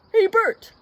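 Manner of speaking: high, loud call to a pal; Ernie. A narrator's reading. Ernie calls to Bert as he reads, high and loud. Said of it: "said Ernie".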